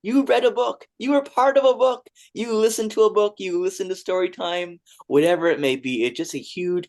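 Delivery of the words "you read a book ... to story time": put-on high, bright, loud congratulations to a young reader; pitched-up celebration of a young reader; joyful cheer. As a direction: cheer the child on like it's a big win. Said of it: "that message is saying".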